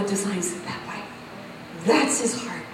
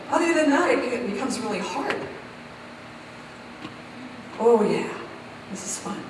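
ordinary delivery

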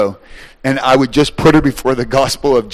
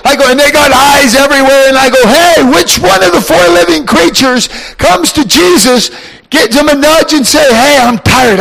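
high, loud preacher's fervour; full-force preaching; 11 semitones higher, 6 dB louder than usual. Acted. Preacher fires up the congregation, loud and high. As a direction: preach it at full volume to the crowd. Preacher retells talk with creatures.

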